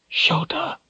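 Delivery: soft, strained, hoarse call; hoarse whisper. A narrator's reading. Richard calls after Shota, hoarse whisper. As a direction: rasp the name under your breath. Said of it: "he whispered hoarsely".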